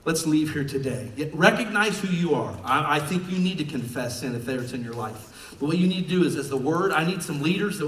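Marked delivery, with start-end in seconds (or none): none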